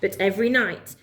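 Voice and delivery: ordinary delivery, nothing marked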